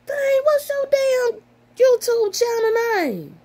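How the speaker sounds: exasperated squeal. Real, not acted. Frustrated, can't find the name.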